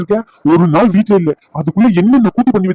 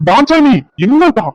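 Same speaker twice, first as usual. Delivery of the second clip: angry shout; 6 semitones higher, much slower than usual. Acted. Husband storms home shouting for his wife, furious drawn-out call. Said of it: "இவன் ஏன் இப்படி கத்திக்கிட்டு வரான்?".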